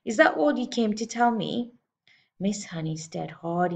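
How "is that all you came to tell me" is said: irritated, raised-pitch question; peeved challenge to an unwanted visitor; ratty. A narrator's reading. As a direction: resent being interrupted. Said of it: "ratty about missing her programme".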